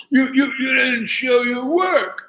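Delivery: high, loud, slow sputtering yell; hammed-up outraged professor shout; mock angry professor. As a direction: imitate the outraged professor, stammering. Professor's angry retort retold.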